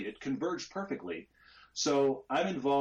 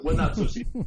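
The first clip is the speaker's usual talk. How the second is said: louder, higher, playfully blunt; mock correction; 7 semitones higher, 7 dB louder than usual. Real, not acted. Admitting jealousy of Tom's home track, louder mock correction. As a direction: correct yourself with a laugh in it. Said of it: "not secretly hate him".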